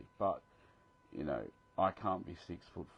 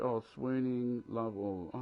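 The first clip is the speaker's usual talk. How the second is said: drawn-out, higher, louder mock swoon; 5 semitones higher, 5 dB louder, much slower than usual. Reading a fan's gushing comment aloud, mock swooning. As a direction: gush it playfully, stretching the words.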